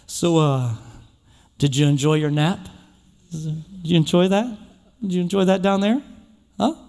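low, teasing I-told-you-so voice, a lightly voiced proud father; smug father gently needling his sleepy son. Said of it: "like a kind of a proud father moment".

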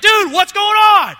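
angry shout at a teammate; yelled. Retold or imitated. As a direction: bark it out, furious and loud. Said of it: "I instantly yelled at him".

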